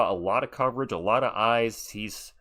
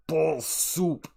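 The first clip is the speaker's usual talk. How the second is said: higher, put-on Austrian voice; lightly hammed-up Austrian action hero; Austrian accent; 5 semitones higher than usual.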